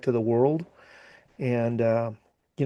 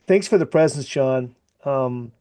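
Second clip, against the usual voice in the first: ordinary talk, nothing marked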